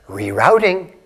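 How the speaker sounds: soft, calm announcement; gently spoken rerouting; gentle. Pretend. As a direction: say it kindly, with no blame. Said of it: "very gently".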